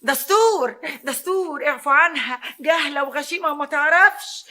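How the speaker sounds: frantic appeasing of offended spirits; loud fearful invocation; alarmed plea to spirits. Acted.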